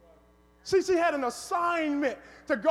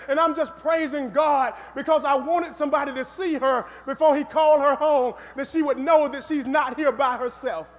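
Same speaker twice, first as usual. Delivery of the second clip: loud, raised pulpit delivery; emphatic sermon storytelling, voice lifted; preaching at full force; 2 semitones higher, 8 dB louder than usual. Real, not acted. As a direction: drive the story's climax home to the congregation.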